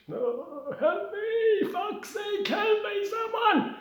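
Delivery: very high, loud agonised screams; screaming for help. Acted. Bus victim begs hospital staff for help, screaming. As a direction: scream desperately for someone to help. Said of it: "screaming in bits".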